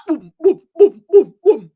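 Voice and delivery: boom sounds. Real, not acted. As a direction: pound out the booms like blasts. Imitating explosions to shake them.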